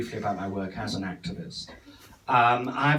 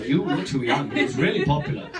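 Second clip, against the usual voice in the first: ordinary delivery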